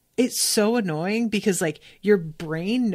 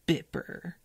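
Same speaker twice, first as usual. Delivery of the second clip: narrowed-eyes menace; 3 semitones lower, 8 dB quieter than usual. Retold or imitated. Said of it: "narrows her eyes and says".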